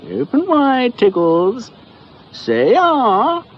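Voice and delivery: high, loud sing-song coaxing; doctor cooing at his tiger. Acted. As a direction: coax the beast like a pet.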